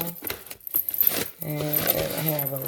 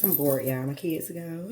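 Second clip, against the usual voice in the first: ordinary delivery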